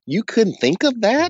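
high, incredulous put-down; upset tone. Retold or imitated. Husband scoffing at his wife's bad day, in a high, incredulous tone. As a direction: throw the question at her like it was obvious. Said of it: "it was all like I was upset".

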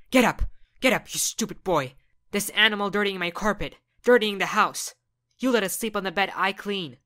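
screaming furious mother; angry mom. Acted. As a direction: rage at the kid, loud.

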